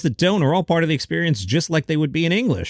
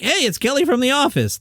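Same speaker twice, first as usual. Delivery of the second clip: put-on higher-pitched voice of an excited teen fan; excited teenage boy; 9 semitones higher than usual. Teen fan greeting an actress at the airport, excited higher voice. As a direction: lightly imitate a thrilled teenage boy, pitched up. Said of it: "A fifteen-year-old boy will be like".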